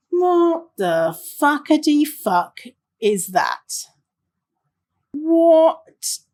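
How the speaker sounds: aghast, word by word; incredulous outrage. Real, not acted. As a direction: stretch each word in appalled disbelief. Aghast at HR weight tracking.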